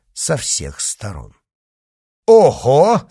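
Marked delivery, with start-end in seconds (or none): 2.3-3.0 loud, high "oho"; startled exclamation. Acted. D'Artagnan is surrounded by riders, startled and loud. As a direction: react sharply to a sudden threat. Surrounded by horsemen, draws sword.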